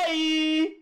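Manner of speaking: "ei" held high with an open e; open diphthong. Shown as a demonstration. Teacher showing the open diphthong, "ei" long and high. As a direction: stretch "ei" high, opening the e wide. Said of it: "ei não".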